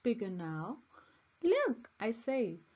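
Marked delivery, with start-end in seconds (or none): 1.4-1.9 high, loud excited shout of look; excited call. Acted. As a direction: call out in sudden delight. Child spots the bird family.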